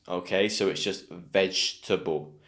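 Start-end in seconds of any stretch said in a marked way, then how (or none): 1.1-2.4 VEGETABLE in three syllables, like vej-tuh-bul; silent middle E. Shown as a demonstration. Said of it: "The E is not pronounced".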